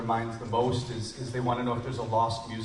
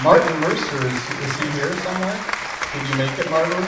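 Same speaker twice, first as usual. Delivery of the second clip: loud call into a crowd; 4 semitones higher, 9 dB louder than usual. Real, not acted. Host looks for a guest in the crowd, calling out. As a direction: project it, searching for someone in the audience.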